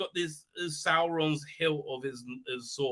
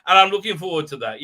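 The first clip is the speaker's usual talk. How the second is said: higher, louder, fast sarcastic gush; sarcastic mock eagerness; 4 semitones higher, 10 dB louder than usual. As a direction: fake enthusiasm to mock the scene. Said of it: "I said".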